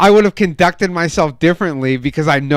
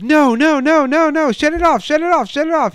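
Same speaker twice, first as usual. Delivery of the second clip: high, urgent yell, rapid repeats; alarmed, high-pitched shouting in retelling; retold yelling; 12 semitones higher than usual. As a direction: yell frantically to make him stop. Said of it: "I start yelling".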